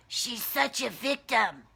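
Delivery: lightly voiced scornful bully, full volume; judgmental bully. Shown as a demonstration. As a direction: sneer it out like a harsh critic. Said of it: "who's saying that?".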